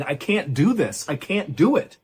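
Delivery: mild scream, pitch rising on the second plea; restrained narrated scream. A narrator's reading. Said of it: "began screaming".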